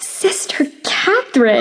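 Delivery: gasping, scandalized cry of her name; shocked gasp. Acted. Young noblewoman scandalized by the nun, shocked gasp.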